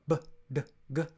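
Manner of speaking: b, d, g buzzing in the throat; teacher demonstrating b, d, g with vibration; voiced sounds. Shown as a demonstration. Teacher to learners, hand on throat, voiced b, d, g. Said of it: "you can feel the voice vibrating here".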